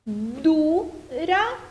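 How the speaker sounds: "Dura" with DU drawn out, RA separate; stress on DU. Shown as a demonstration. Teacher showing the stressed syllable, "Dura" with a long DU. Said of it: "o du é a sílaba mais forte".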